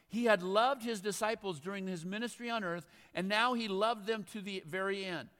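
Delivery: loud, projected Bible reading; scripture reading. A narrator's reading. Preacher reads the Last Supper to the congregation, loud and declaimed. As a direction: read it out strongly to the whole room.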